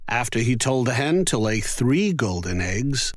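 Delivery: ordinary delivery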